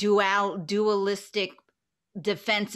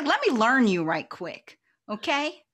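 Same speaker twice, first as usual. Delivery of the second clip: sassy retort; 5 semitones higher, 8 dB louder than usual. Retold or imitated. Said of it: "But I was like".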